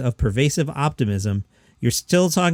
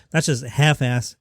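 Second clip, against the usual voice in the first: ordinary delivery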